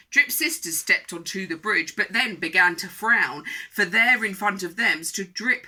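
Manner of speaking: lively, projected read-aloud; rhythmic storybook telling; children's story narration. A narrator's reading. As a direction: read it to small children with relish. Reads a picture book.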